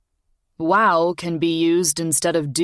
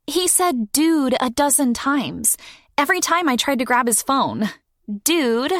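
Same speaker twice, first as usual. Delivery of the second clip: put-on much higher girl's voice, leaning on dude; young girl trying out a word; 10 semitones higher than usual. A narrator's reading. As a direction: test out dude with relish. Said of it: "she says with emphasis".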